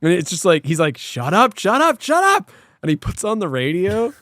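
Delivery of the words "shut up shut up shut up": mock rage climbing in pitch; imitated outburst rising to a high pitch; retold rage. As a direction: act out snapping, each repeat higher. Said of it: "so angry. He's, like, in this rage".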